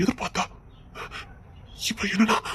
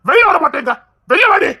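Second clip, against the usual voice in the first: much higher, yelled call; shouting for her to come outside; calling out angrily; 8 semitones higher than usual. Acted. Husband yelling for his wife to come out.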